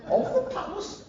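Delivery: prim royal surprise; Queen impression.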